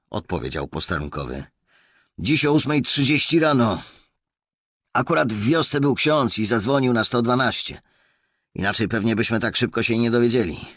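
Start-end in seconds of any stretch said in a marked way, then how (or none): 2.2-10.6 high, loud policeman's voice; young constable reporting. A narrator's reading. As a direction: report eagerly to a superior, pitched well up. (wysoki, głośny głos posterunkowego)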